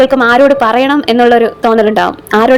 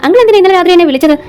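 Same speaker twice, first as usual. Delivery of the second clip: quick, higher-pitched stern demand; stern questioning; 6 semitones higher, faster than usual. A narrator's reading. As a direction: confront him briskly in a hard tone. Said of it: "കടുത്ത സ്വരത്തിൽ ചോദിച്ചു". (കടുപ്പിച്ച സ്വരത്തിൽ വേഗത്തിലുള്ള ചോദ്യം)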